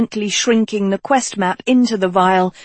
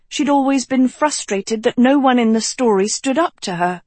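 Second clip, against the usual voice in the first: usual speaking voice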